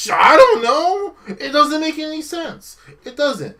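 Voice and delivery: exasperated laugh. Real, not acted. Baffled by the story.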